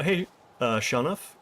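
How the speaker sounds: normal talking voice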